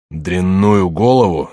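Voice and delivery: slow, heavy roar of outrage. Acted. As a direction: bellow the insult back. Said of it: "заревел Коконна".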